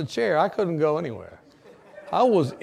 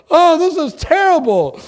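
mock dismay; 12 semitones higher, 12 dB louder than usual. Reacting to patient surviving.

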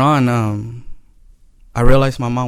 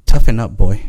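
harsh command; 9 dB louder than usual. Retold or imitated. Mother brushing off her son.

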